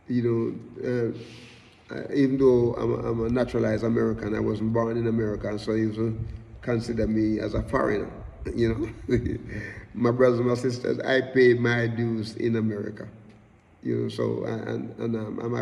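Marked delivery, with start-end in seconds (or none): none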